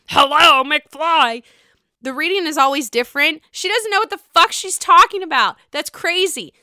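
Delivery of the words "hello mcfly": loud, high "Hello? McFly?" knocking-on-head call; Back to the Future McFly. Retold or imitated. Quoting Biff to eighties babies, loud and mocking. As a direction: bang on their skull with the words. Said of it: "You remember what I'm talking about?".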